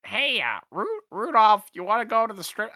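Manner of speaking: shaky attempt at a high character voice; Talon. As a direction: pitch it way up, fumbling for the character. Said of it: "I can't do his voice".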